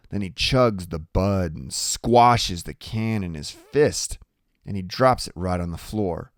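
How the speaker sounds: plain reading voice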